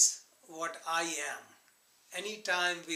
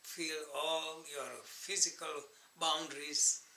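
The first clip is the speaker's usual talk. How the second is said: guided meditation. Acted. Teacher guiding meditators to observe the breath, calm guided meditation. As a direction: lead them inward in calm, spaced-out phrases.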